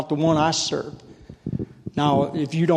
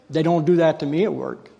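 ordinary talk

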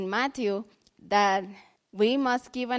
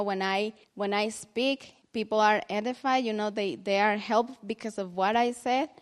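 usual speaking voice

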